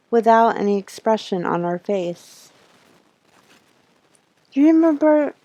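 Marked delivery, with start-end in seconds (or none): 4.5-5.3 lightly voiced girl, higher and louder; voicing Lisa. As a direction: lift into a young girl's voice for Lisa. Lisa's line in visual novel.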